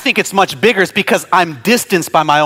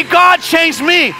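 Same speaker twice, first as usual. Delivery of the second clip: much higher, loud, full-force preached prayer; fervent prayer; 11 semitones higher, 4 dB louder than usual. Shown as a demonstration. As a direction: cry out to God for healing at full force. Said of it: "I gotta say".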